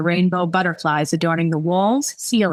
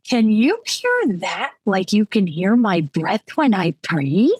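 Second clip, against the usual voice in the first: slow, swooping tipsy question; drunk questioning; slower than usual. Acted. Drunk Clara asks over phone.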